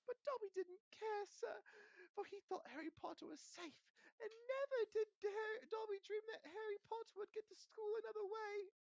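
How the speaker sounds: a tiny servant sobbing out his plea; Dobby begs Harry, crying in a squeaky voice; weeping house elf. Acted.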